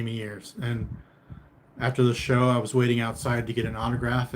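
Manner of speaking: ordinary talk, nothing marked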